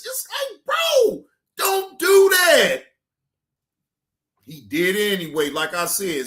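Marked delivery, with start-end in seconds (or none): 0.0-2.8 high, loud protest, last words stretched out; exasperated outburst. Acted. Host scolding the man for outing someone, exasperated outburst. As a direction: plead loudly in a high, incredulous voice.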